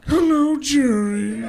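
high, loud sitcom nemesis greeting; Newman. Acted. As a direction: greet your rival high, then glide down. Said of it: "the guy who plays Newman".

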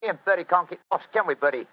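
high, loud Cockney workman; Cockney accent. Acted. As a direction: bluff Cockney, chatty and loud. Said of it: "My Cockney accent doesn't compare with yours".

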